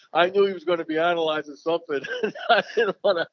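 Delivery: chuckling through the words; amused, half laughing. Real, not acted. Laughing about avoiding rival's eyes.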